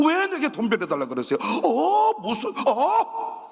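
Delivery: high, startled stammering refusal; flustered refusal. Retold or imitated. Famous pastor recoiling from a loan request, flustered. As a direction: act the rich pastor caught off guard.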